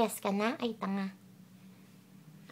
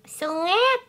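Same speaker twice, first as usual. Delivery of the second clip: higher, louder teasing shout of Sungit; teasing the grumpy dog, louder; loud teasing call; 7 semitones higher, 7 dB louder than usual. Real, not acted.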